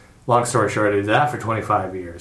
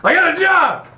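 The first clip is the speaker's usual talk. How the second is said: bursting out much higher, real delight; excited; 4 dB louder than usual. Real, not acted. Telling friends about his new job, excited. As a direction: blurt the news with real joy.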